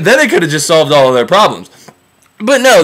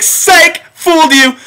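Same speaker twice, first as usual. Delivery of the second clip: high-pitched mocking gotcha; spring the trick with a high jeer; gleeful taunt; 9 semitones higher, slower than usual. Trickster to the one he fooled, gleeful taunt. Trickster gloats over broken promise.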